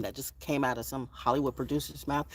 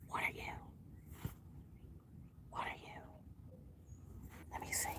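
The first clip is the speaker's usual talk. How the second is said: whisper; 20 dB quieter, faster than usual. Real, not acted. Whispered to the bird.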